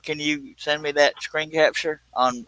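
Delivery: usual speaking voice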